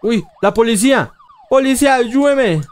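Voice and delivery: high, loud desperate plea; begging for help. Real, not acted. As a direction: call to the police in panic. Begging police.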